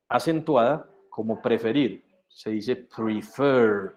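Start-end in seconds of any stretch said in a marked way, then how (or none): 2.8-3.9 PREFER with the voice raised on FER; second-syllable stress. Shown as a demonstration. Teacher shows an accented second syllable, PREFER stressed on FER. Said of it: "subo la voz en fer".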